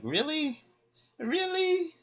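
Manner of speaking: squeaky high, disbelieving Really; incredulous Really. Retold or imitated. Someone back home, faking stunned disbelief. Exasperated return after leaving.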